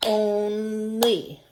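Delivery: "Only" split into two drawn-out beats; clapped-out syllables. Shown as a demonstration. Teacher clapping out syllables with pupils, slow split "Only". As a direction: hold each syllable of "Only" evenly, OHN, LEE. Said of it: "Let's try clapping that out".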